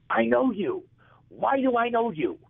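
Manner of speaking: booming comic heckle from behind; Don Rickles voice. Retold or imitated. Old comic calls out to a guest from his chair, high and brash. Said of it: "and it's Don Rickles".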